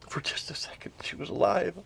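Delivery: near-whisper, heavy with loss; hushed. Real, not acted. Son remembering his mom briefly alive, hushed. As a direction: let the voice fall away to a whisper. Grieving the moment mother seemed alive.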